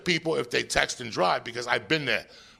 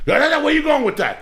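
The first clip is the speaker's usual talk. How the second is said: loud protest; 13 semitones higher, 5 dB louder than usual. Real, not acted. Protesting loudly.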